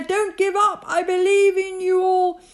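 high, impassioned call; rally the small folk earnestly; calling out encouragement. A narrator's reading. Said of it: "he managed to call to the mayor".

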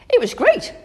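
put-on high, loud burst of a girl's delight; excited cry. A narrator's reading. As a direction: cry it out, thrilled to share the news. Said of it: "cried Amy".

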